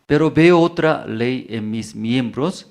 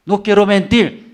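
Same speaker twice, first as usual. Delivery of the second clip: higher and louder, pleading not to lie; earnest cry; 8 semitones higher, 7 dB louder than usual. Acted. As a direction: cry out the resolve. Creyente que no quiere mentir. (grito sincero)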